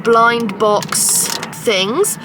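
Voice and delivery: normal talking voice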